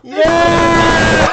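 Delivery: high, loud cheering shout; crowd cheer. Acted. Congregation cheers for the resurrected parrot.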